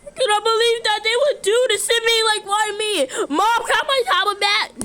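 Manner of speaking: high, fast pretend whine; whining child. Child asks mom for tablet back, whining. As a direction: plead like a hard-done-by kid.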